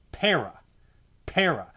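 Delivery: Para with the first syllable punched; accent on PA. Shown as a demonstration. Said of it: "you're accenting the first syllable".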